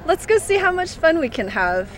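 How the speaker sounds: ordinary delivery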